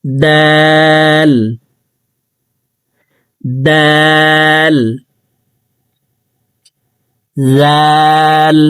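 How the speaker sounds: দাল and জাল held long on a steady tone; drawn-out letters. Shown as a demonstration. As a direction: stretch each letter name slowly. Teacher drawing out letter names.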